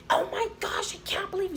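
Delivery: hammed-up high exasperation; imitated ranting. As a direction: mimic a scandalized boss in a high voice. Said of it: "after she did all that screaming".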